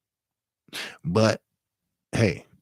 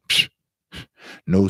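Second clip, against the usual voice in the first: ordinary talk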